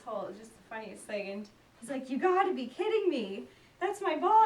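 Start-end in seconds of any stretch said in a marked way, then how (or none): 2.1-4.4 higher, louder, thrilled disbelief; can't believe his luck; amazed. Retold or imitated. Golfing dad finding his ball in the hole, excited disbelief.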